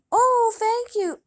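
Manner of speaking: high and loud, overjoyed thanks; delighted gasp. A narrator's reading. Cinderella thrilled to get the flute from her friends.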